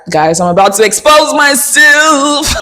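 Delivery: high, drawn-out playful wail; sing-song confession. Pretend. Host about to confess to viewers, playful drawn-out wail. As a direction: stretch the last word out high in mock dread.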